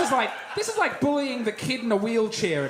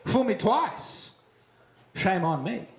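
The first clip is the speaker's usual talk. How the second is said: mock solemn proverb; 10 semitones lower than usual. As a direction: recite it like fake wisdom, voice dropping. Mock proverb routine, mounting self-pity.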